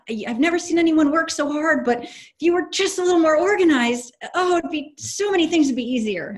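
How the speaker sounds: high, sweet put-on employee voice; a worker's polite, high complaint to the boss; saying it nicely. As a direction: gently scold the boss, sugar-coated. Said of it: "They say it really nicely".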